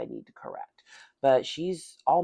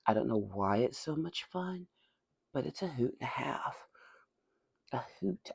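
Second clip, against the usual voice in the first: ordinary talk, nothing marked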